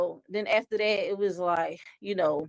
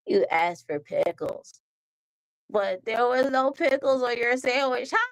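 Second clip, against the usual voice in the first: put-on cartoon voice, teasing reveal; spring the joke with a smug ha; SpongeBob gloating; 3 semitones higher than usual. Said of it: "He was like".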